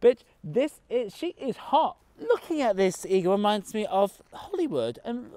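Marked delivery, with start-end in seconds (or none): none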